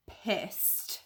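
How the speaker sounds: drops to a breathy whisper on pissed. Real, not acted. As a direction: hiss the word out under your breath. Angry aside, voice dropped.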